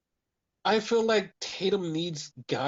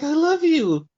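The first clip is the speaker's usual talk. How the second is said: high, loud, hammed-up lovestruck voice; mock love confession; 10 semitones higher, 6 dB louder than usual. Retelling Izzy's confession to Tatum, mock high lovestruck voice. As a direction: swoon it out like a cheesy wrestler.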